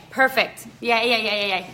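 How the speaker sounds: ordinary talk, nothing marked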